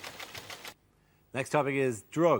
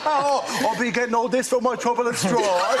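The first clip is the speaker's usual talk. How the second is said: higher-pitched, badly faked Cockney accent; mock Cockney; 5 semitones higher than usual. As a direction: have a go at Cockney. Joins in mock Cockney rhyming slang.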